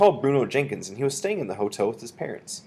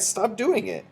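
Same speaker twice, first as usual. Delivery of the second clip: high-pitched protest; crying out; 12 semitones higher, slower than usual. A narrator's reading. Narrator boy tells Bruno to stop, high protest. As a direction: plead it high, objecting. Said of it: "I cried".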